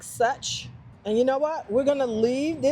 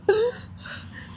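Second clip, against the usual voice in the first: frightened shaky sigh; 11 semitones higher than usual. Real, not acted. Panics over a worm.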